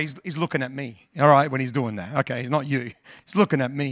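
ordinary delivery

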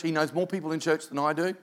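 ordinary talk, nothing marked

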